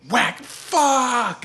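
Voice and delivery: high, loud howl of a curse; pained yell. Retold or imitated. Father struck while wrenching, pained yell. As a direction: stretch it out in mimicked pain. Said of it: "he'll yell".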